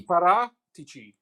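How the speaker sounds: Paratici slowly broken up, "para ... tee-chee"; teaching correct pronunciation. Shown as a demonstration. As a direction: spell out Paratici by sound, slowly, ending in "chee". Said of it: "C I is pronounced chi".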